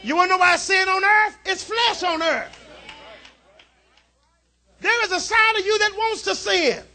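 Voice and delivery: loud, high-pitched sermon climax, shouted; preaching at full force. Acted. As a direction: whoop it up to the pews.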